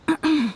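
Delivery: a short clear of the throat before speaking; steady yourself with a little cough; nervous throat clearing. Real, not acted. Ayaka clearing her throat before asking the Traveler out. Composing herself before invitation.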